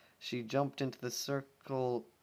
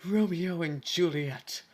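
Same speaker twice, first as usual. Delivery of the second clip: 1 hammed-up dramatic aside; 7 semitones higher, 7 dB louder, slower than usual. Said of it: "dramatic aside".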